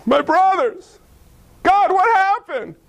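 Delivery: put-on a boy lost in a pit; mild distress from the pit; distressed cry. Joseph crying from the pit.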